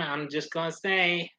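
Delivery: ordinary delivery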